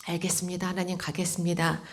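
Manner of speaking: low, soft yielding reply; hushed obedient answer; quiet acceptance. Retold or imitated. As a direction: give in softly, a little low. Resigned prayer of consent.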